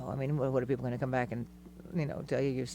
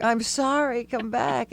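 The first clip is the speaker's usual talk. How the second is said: higher, hammed-up plea; a light plaintive call after someone leaving; mock pleading; 8 semitones higher, 4 dB louder, much slower than usual. Wife begging husband who already went home, mock pleading higher.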